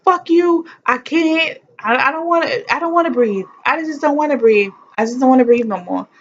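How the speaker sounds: high, pained furious wail; howling in pain, refusing to breathe; retold labor outburst. Woman in labor refusing to breathe, high pained yelling. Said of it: "I was like".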